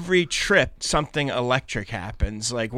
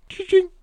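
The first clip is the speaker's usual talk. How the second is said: much higher, louder sung ding-ding; imitated intercom chime; 5 dB louder than usual. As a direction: mimic the plane chime. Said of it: "he just goes".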